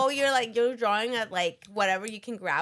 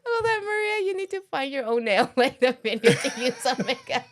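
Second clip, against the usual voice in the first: teasing through laughter, louder; laughing; 3 semitones higher, 3 dB louder than usual. Real, not acted. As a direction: giggle your way through the jab. Teasing, laughing mid-sentence.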